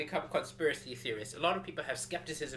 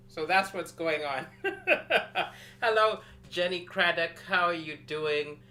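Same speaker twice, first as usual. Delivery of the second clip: ordinary delivery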